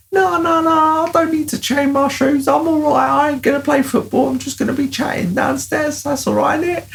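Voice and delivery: put-on high whiny boy insisting he's fine; squeaky put-on child talking his mum round; pleading kid voice. Kid assuring mum he won't play football, high and whiny.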